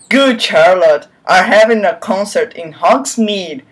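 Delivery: normal talking voice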